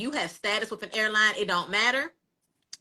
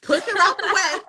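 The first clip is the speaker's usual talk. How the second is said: excited; 8 semitones higher, 5 dB louder than usual. Retold or imitated. Retelling pushing past a lady.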